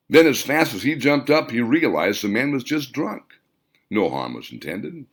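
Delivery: plain reading voice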